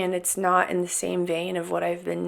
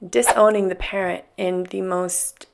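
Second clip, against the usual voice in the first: normal talking voice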